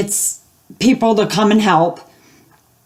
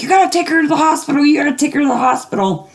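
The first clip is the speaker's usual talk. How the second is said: panicked; 6 semitones higher, much faster than usual. Retold or imitated. Mom panicking over injured knee.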